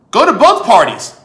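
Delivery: usual speaking voice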